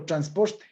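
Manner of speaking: transporte with a strong, hissed R before the T; accentuated sierra R. Shown as a demonstration. Teacher demonstrating sierra speech, transporte with accentuated R. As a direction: stress the R in transporte like an Ecuadorian highlander. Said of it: "una pronunciación acentuada de la R".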